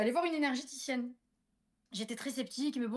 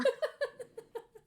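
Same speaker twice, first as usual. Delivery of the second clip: high burst of laughter; 14 semitones higher than usual. Real, not acted. Laughing at her own cat story, high laugh.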